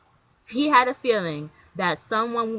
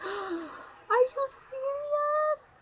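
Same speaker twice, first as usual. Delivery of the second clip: very high, slow, stretched-out "Are you serious? What?"; shocked disbelief; 13 semitones higher, 8 dB louder, much slower than usual. Retold or imitated. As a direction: replay the moment the news hit you.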